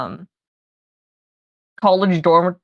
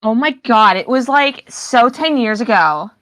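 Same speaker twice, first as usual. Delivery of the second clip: put-on drawn-out valley girl accent, slow on ten years ago; mock valley girl dismissing an old trend; 4 semitones higher, 3 dB louder, slower than usual. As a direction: gush it like a valley girl at the mall. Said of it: "I can do that accent".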